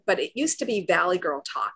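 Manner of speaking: ordinary talk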